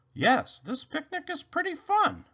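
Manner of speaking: high-pitched, upbeat rabbit voice. A narrator's reading. Rabbit tells Robot the picnic is fun, high-pitched. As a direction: hold it high, drop on the last word. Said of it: "said Rabbit".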